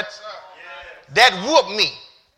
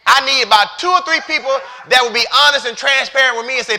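loud, high-pitched sermon shout; full-force preaching; 11 semitones higher, 9 dB louder, slower than usual. Real, not acted. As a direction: drive it home to the congregation at the top of your voice.

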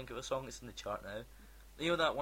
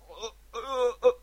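mock mumbled lyrics; 9 semitones higher, 10 dB louder, slower than usual.

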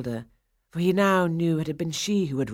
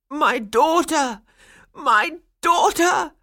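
put-on high, slow joyful old man's cry; old man crying out in delight; 12 semitones higher, 7 dB louder, slower than usual. A narrator's reading. Toymaker crying out in delight at his doll daughter, high and slow. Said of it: "Coppelius cried".

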